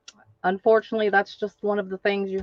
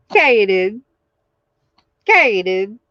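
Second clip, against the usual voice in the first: usual speaking voice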